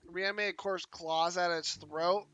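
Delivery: usual speaking voice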